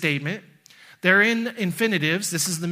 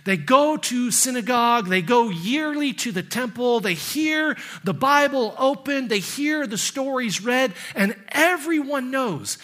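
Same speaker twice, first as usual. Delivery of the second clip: rising, higher preaching toward a climax; building sermon; 4 semitones higher than usual. Acted. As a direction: build the passion line by line.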